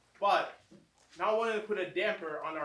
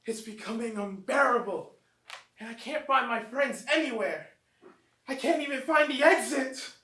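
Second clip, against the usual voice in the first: growing desperation; 5 semitones higher, 5 dB louder than usual. Acted. Trapped narrator growing desperate.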